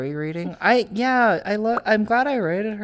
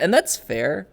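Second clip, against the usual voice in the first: usual speaking voice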